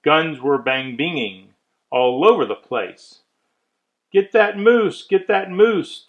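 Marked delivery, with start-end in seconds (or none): 4.1-6.0 loud, higher hunter's cry; calling out. Acted. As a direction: hunters call after the moose, loud and higher. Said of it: "heard a voice call".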